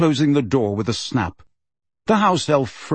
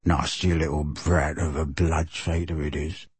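audible elf mutter; 7 semitones lower, 5 dB quieter than usual. A narrator's reading. Kreacher insulting Fred right after bowing, low and grumbling. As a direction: grumble it low but clearly. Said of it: "perfectly audibly".

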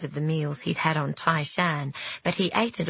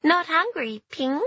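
put-on squeaky baby dragon; 11 semitones higher than usual.

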